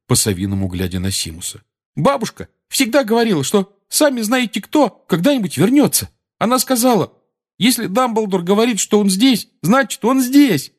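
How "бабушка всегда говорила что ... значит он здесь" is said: put-on high-pitched earnest boy's voice; emphatic, slowing on the final conclusion; Neville. A narrator's reading. Neville passing on his grandmother's verdict, high and earnest.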